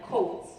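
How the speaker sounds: "coats" with a Canadian o; Canadian vowel. Shown as a demonstration. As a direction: say "coats" with a long, pure Canadian o. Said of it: "only comes out in certain words".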